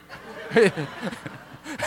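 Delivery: bursting out laughing; a real laugh at his own story. Real, not acted.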